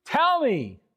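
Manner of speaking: insist, almost ordering God; demanding God answer. Acted.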